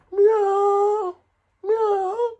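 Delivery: high squeaky meows; cat meow. Pretend. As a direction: pretend to be a cat in the tree. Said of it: "so him hear".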